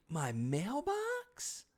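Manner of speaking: very slow, high whine of disbelief; my mailbox, really?; drawn-out disbelief. Retold or imitated. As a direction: stretch it out, aghast. Incredulous about the smashed mailbox.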